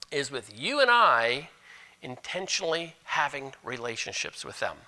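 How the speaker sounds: normal talking voice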